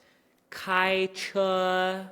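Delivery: "开车" very slow, flat high tone; practicing tones. Shown as a demonstration. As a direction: stretch "开车" on one level pitch. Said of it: "flat the entire time".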